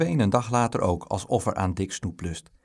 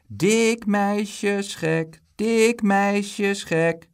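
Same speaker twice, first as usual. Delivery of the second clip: slow, high schoolyard chant; stretched, high-pitched teasing yell; shouted taunt; 10 semitones higher, 5 dB louder, much slower than usual. A narrator's reading. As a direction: shout a drawn-out sing-song jeer. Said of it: "schreeuwde Bruin Boon".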